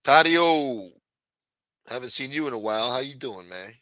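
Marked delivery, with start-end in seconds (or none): 0.0-0.9 loud, high hail; loud greeting. Real, not acted. Streamer hailing a viewer, loud. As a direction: call the name out.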